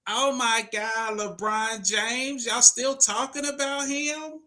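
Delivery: very high, drawn-out whine; mock the haters in a squeaky voice; whiny hater voice. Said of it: "the little whining voice, the hater voice".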